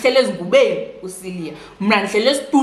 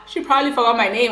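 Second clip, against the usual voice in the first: laughing; 5 semitones higher, faster than usual. Real, not acted. Laughing at her own thought.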